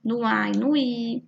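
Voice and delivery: ordinary talk, nothing marked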